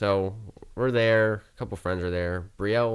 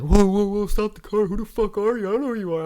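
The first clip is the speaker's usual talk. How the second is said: much higher, louder alarmed yelling; mock freak out at a stranger; drunk panic; 12 semitones higher, 6 dB louder than usual. Drunk friend panics at the driver, high mock yelling.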